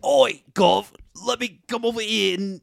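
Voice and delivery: high, loud mock-Cockney greeting; hammed-up old-timey Cockney; Cockney accent. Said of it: "like, a Cockney accent".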